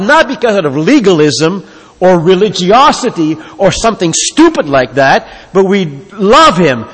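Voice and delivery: loud, rising preacher's build, pressing each line home; preaching at full force. Acted. Preacher urging the congregation to walk with God, full-force preaching.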